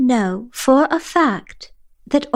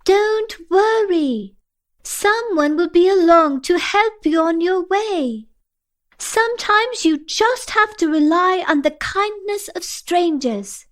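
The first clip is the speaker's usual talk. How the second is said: yelling out; 8 semitones higher than usual. A narrator's reading. Asha hovering over the abyss yells, reassuringly. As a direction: call reassurance across a great distance. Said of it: "she yells out".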